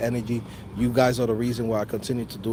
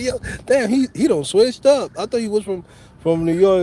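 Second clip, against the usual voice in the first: higher, louder, amazed hometown buddies; teasing friends; 7 semitones higher, 5 dB louder than usual. Retold or imitated. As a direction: act out the crew clowning him for switching up. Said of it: "motherfuckers be like".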